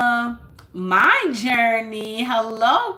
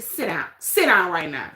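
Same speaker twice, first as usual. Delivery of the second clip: stern sit-down order; 4 semitones lower than usual. Retold or imitated. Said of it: "I was so mad".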